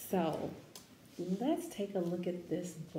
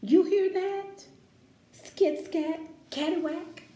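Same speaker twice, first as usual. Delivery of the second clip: much higher and louder, bursting with jazz; excited scat call; 10 semitones higher, 9 dB louder, slower than usual. Acted. Teacher reading jazz sounds to the class, loud and excited. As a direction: belt out the scat like a trumpet.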